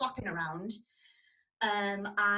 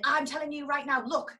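retold threat; 6 semitones higher, 5 dB louder than usual. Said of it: "And he said".

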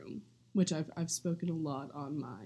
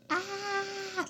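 sudden high-pitched squeal. Shown as a demonstration. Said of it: "like that".

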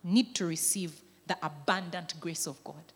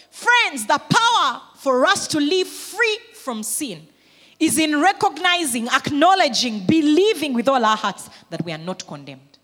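much higher, louder and slow, drawn out; proclaiming Jesus' words; 6 semitones higher, 10 dB louder than usual. Acted. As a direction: proclaim each phrase like a verdict of grace. Preacher at full force.

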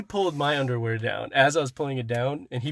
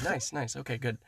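softer, brisk routine approval; offhand quick nice; 9 dB quieter than usual. Shown as a demonstration. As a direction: toss off "Nice, nice" like a busy doctor. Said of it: "or was it like".

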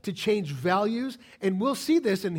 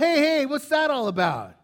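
puzzled retort; 7 semitones higher, 6 dB louder than usual. Retold or imitated. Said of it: "And I'm like".

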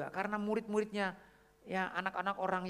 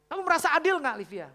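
loud, high-pitched retold telling-off; stern principal scolding; 10 semitones higher, 11 dB louder than usual. Principal scolding cheating pupils in front of everyone, stern and loud. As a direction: imitate a strict principal, voice raised hard. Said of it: "kepala sekolahnya itu tegas banget".

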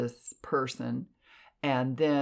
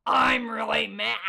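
mad scream; 9 semitones higher, 3 dB louder than usual. Shown as a demonstration. Showing how she screamed at a spirit, mad yell. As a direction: scream it as if fed up. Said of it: "I was screaming like".

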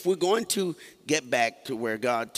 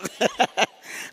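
loud laugh; 11 semitones higher, 4 dB louder than usual. Real, not acted. Laughing at own joke on stage.

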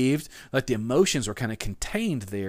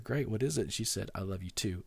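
soft retold exchange; 4 semitones lower, 9 dB quieter than usual. Retold quiet goodnight exchange.

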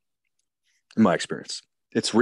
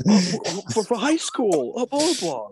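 high put-on falsetto patter; mock excited recognition; 13 semitones higher than usual. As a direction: imitate gushing recognition in a high voice. Acts out film pickup dialogue.

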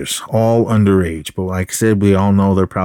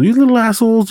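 mock angry yell; 13 semitones higher, faster than usual. Fed-up teacher tells off his students, mock yelling. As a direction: imitate a teacher losing patience. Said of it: "he was just yelling at all of them".

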